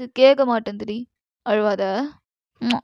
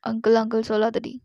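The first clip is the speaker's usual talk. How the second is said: ordinary talk, nothing marked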